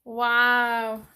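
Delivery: ordinary talk, nothing marked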